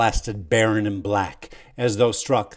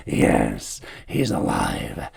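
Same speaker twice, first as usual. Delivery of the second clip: breathy gasp, half laughing; 12 semitones lower, much slower than usual. Acted. Dying servant gasps to the anxious nephew. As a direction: gasp it out through a choke. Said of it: "gasps the man as he gives a choking laugh".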